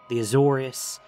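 ordinary delivery, nothing marked

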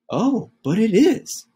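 Mama kindly turning it around; gently reassuring Mama. Acted. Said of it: "answered Mama".

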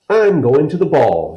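little girl playing pretend, pitched high. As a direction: lift into a high make-believe voice. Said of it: "in a high pretend voice".

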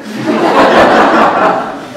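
loud high burst of laughter; big laugh. Real, not acted. Laughing hard at Buster's letter to Diet Sprite. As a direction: crack up at the memory.

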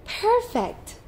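very high storybook delight; delighted exclamation. A narrator's reading. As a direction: exclaim it high as a thrilled child character. Sam delighted at job offer.

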